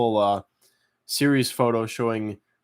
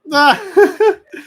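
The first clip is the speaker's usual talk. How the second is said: high laugh; 11 dB louder than usual. Real, not acted. Reacts to dog in hallway.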